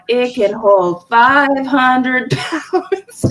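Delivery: very drawn-out mock boast, ending in laughter; mocking catchphrase. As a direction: stretch the boast out as a running joke. Their mocking joke of the boast.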